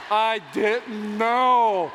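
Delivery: very slow, drawn-out excuse; mock pleading. Husband excusing himself to the ladies, drawn-out mock plea. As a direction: stretch out every word in mock innocence.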